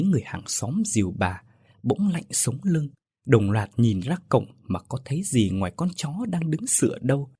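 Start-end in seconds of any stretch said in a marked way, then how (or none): none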